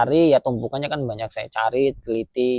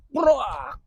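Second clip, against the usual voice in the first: imitated crash; 11 semitones higher than usual. Iron bars thrown at him.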